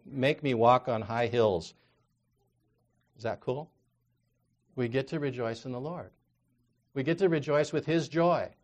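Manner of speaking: normal talking voice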